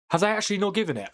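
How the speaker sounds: fast, high incredulous question; disbelief. Real, not acted. Streamer reacting to the referee's decision, fast high disbelief. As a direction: rush it out, stunned. Penalty not awarded, disbelief.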